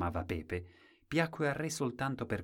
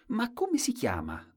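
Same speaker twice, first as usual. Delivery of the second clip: put-on curious boy; 12 semitones higher than usual. Boy asks the name.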